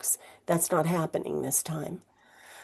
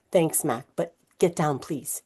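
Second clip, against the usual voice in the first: usual speaking voice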